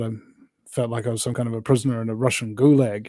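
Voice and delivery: ordinary talk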